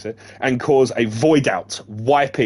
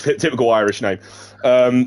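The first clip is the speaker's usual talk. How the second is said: plain reading voice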